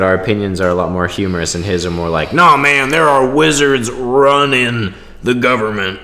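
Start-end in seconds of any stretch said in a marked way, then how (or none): 2.3-6.0 louder, higher mock conspiracy ranter; Alex Jones impression. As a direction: ham up a ranting radio host. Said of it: "It's so hard to do his voice".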